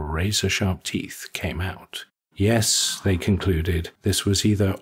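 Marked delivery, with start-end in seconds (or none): none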